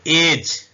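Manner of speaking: loud एज said as "ej", final e silent; model word. Shown as a demonstration. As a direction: announce एज loudly, no final vowel. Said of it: "अब इसको ई नहीं पढ़ेंगे".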